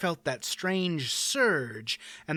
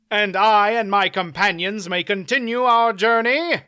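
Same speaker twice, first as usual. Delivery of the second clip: courtly knight voice; 6 semitones higher, 6 dB louder than usual. Acted. Knight asks formally.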